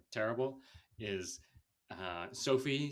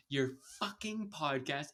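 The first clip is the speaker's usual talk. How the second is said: "fucking" with its first syllable hit hard and rising; stress on fucking; 4 semitones higher than usual. Retold or imitated. Podcaster imitating Jordan stressing fucking. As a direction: lean on the fu- of "fucking". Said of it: "she emphasizes the first".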